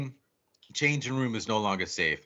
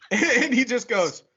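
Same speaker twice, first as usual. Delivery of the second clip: high, laughing retelling; real laughter breaking into the words; cracking up; 8 semitones higher, 4 dB louder than usual. Real, not acted. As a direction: barely get the quote out through laughter.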